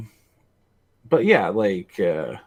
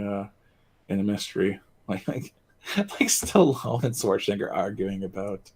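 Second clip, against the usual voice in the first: normal talking voice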